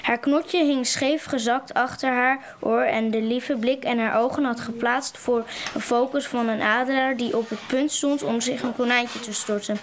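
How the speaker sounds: plain reading voice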